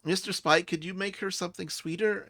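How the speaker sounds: high-pitched character asking a favor; Zale. Acted. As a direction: ask sweetly, pitched well up. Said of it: "and Zale goes".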